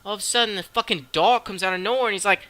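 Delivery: ordinary delivery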